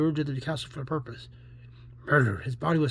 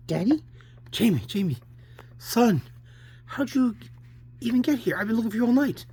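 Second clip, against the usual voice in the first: raised, urgent searching-parent voice; anxious father; 8 semitones higher than usual. Acted. Father who has looked all night for his son, urgent. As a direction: call out to a lost child in relief.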